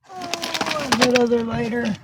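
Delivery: light up at finding it; oh, a sudden relieved find; pleased surprise. Real, not acted.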